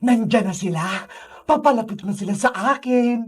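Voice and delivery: low, sinister ghost villain; Sadako villain voice. Acted. Sadako watching the children come closer, low and sinister. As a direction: gloat to yourself, pitched well down.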